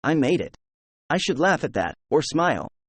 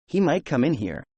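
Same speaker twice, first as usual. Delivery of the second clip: plain reading voice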